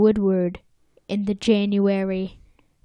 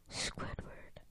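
hushed, mock-mournful whisper; pitying whisper; 23 dB quieter than usual. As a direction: whisper the name in mock sympathy. Pitying Squidward, name trails to whisper.

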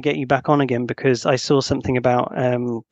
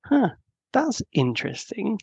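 higher, softer musing; playful curiosity; 7 semitones higher, 4 dB quieter than usual. Acted. Boyfriend reacting to partner without fear, playful curiosity. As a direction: ponder it with playful interest. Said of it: "having that kind of playfulness".

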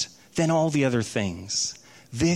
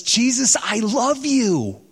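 higher, slow, syrupy tender voice of God the Father; overly sweet, drawn-out love declaration; lightly voiced, sentimental retelling of a storyteller's version; mock tender; 5 semitones higher, slower than usual. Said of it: "it kind of bugged me the way he told it".

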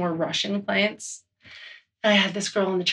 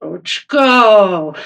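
loud, joyful shout of his nickname; calling out; 9 dB louder than usual. Real, not acted. Greeting a coworker across the room with a joyful shout.

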